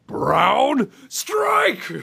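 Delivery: high yelled battle cry; shout. Pretend. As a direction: yell it like a fight move. Mock toilet announcement shout.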